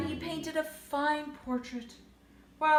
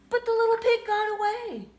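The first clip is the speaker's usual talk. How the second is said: excited escape; 9 semitones higher, 7 dB louder than usual. A narrator's reading. Narrator exclaims the escape.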